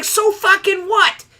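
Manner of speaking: high, slow, incredulous outburst; exasperated retort. Retold or imitated. Angry at coworker's lame excuse, high slow exasperated shout. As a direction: fling it back at him in disbelief. Snapping back at Randy's excuse.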